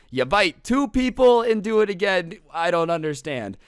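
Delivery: high, loud comic rant; hammed-up exasperation, pitched high and loud; exasperated disbelief. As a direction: build the outrage as the count goes up.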